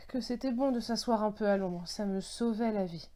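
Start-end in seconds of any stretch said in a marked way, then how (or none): none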